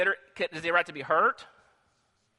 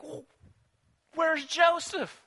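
shocked question; 10 semitones higher, slower than usual. Acted. Reuben finds the pit empty.

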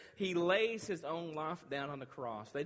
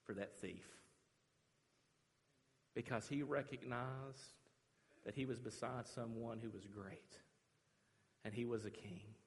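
hushed preaching; 2 semitones lower, 6 dB quieter than usual. Real, not acted. Preacher reflecting on the freed thief, hushed and reverent. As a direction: let each phrase sink in quietly.

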